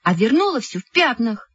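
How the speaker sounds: high-pitched scolding complaint; indignant reproach. A narrator's reading. Girl accuses her friend of staining a book, indignant.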